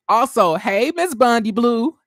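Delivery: hyped up shout-out to a favorite; excited shout-out. Real, not acted. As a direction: call her name out with delight. Shouting out a cast member.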